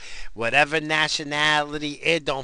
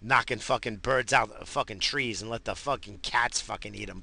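ordinary talk, nothing marked

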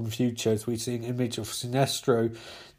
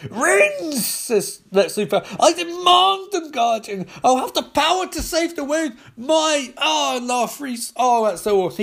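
much higher, louder greedy demand; Lex Luthor demanding; 12 semitones higher, 4 dB louder than usual. Acted. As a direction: grab for the power with greedy zeal.

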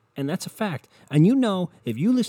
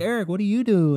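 higher and louder, all eager interest; eager gushing question; 10 semitones higher, 5 dB louder than usual. Retold or imitated. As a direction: gush over him like he's the favourite. Relatives eagerly quizzing Eric.

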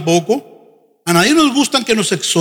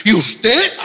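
mock accusation. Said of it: "espíritu de rebelión".

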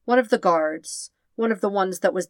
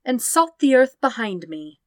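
higher, louder, commanding sister; Azula's fierce echo, higher and louder; 6 semitones higher, 6 dB louder than usual. A narrator's reading. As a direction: ring out like a voice echoing in his head. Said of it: "Azula's voice echoes in his head".

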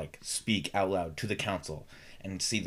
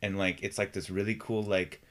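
ordinary talk

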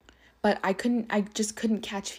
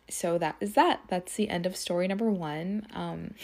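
normal talking voice

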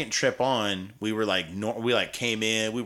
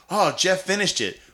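much higher, louder whooping cheer; mock crowd cheer; 11 semitones higher, 8 dB louder, slower than usual. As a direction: cheer Jeff like a rowdy bar crowd.

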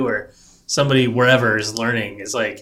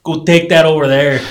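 fast, laughing, hammed-up Minnesota accent; bad Minnesota accent; 4 semitones higher, 8 dB louder than usual. Boss handing a designer a task, bad Minnesota accent. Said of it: "It's a bad accent".

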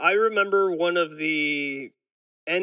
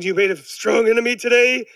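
higher and louder mock menace; Burns threat; 6 semitones higher, 6 dB louder than usual. Burns cursing the vending machine, in a higher hammed-up threat. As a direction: threaten the machine like a petty villain. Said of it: "Mister Burns is like".

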